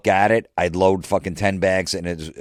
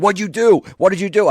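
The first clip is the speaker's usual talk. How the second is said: high, loud, urgent demand; frantic accusation; 14 semitones higher, 6 dB louder than usual. Retold or imitated. Burning boss confronting his laughing employee, frantic high shouting. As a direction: shout the question in outraged panic.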